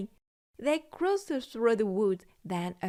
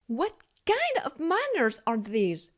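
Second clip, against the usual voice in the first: shouting; 6 semitones higher, 3 dB louder than usual. A narrator's reading. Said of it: "shouted Mister Nutcracker".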